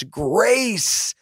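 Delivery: high, long call, kept fairly soft; mock shout. Preacher voicing shouts of grace to the temple, high restrained call. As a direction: stretch the word upward like a distant cheer. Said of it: "And so they shouted".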